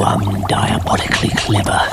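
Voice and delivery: ordinary delivery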